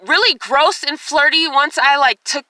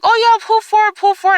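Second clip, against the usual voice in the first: high, louder imitation of an eager doctor; mock doctor; 8 semitones higher, 4 dB louder than usual. Smiling doctor tells uncomfortable patient to move, high mock voice. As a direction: mimic his pushy instruction, pitched up. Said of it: "all smiling".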